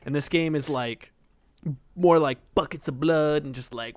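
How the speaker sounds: usual speaking voice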